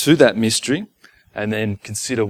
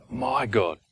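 soft, hushed address to God; personal plea; 3 semitones lower, 8 dB quieter than usual. Acted. Forsaken man turning back to his own God, softly. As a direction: drop to a quiet, intimate plea. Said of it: "This is personal".

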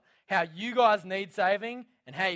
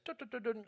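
high, quick "dut" clicks; imitated winding noise; 7 semitones higher, 6 dB quieter, faster than usual. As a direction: mimic a crank winding up in light, high ticks. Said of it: "it just goes".

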